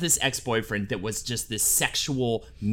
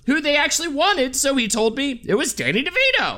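higher, lightly nasal-free voice of a fussy actor; imitating a famous actor's voice, higher; Wallace Shawn impression; 10 semitones higher than usual. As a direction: read it as the actor himself, higher. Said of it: "I'll do a quote in his own voice".

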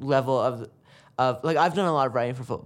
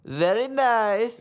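put-on drawn-out, much higher Borat voice with Kazakh accent; 10 semitones higher, 3 dB louder, much slower than usual. Asked for Borat's catchphrase, high slow Borat accent. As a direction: stretch it slowly like Borat. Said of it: "What does he say when he really enjoys something?".

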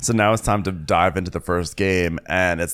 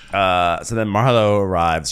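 normal talking voice

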